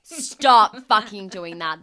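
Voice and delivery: slow, loud, emphatic telling-off; loud scolding. Acted. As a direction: hammer each word out slowly. Scolding people who eat boogers.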